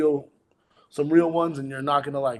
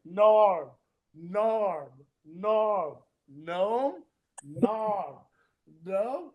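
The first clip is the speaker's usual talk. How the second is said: back-and-forth mimic; 4 semitones higher, much slower than usual. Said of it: "him and the announcer was like".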